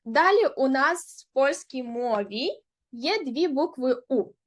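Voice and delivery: ordinary talk, nothing marked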